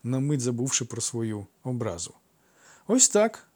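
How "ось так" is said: high, pert little answer; sly grin. A narrator's reading. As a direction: toss it off with a sly smile. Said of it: "посміхнувся лукавий".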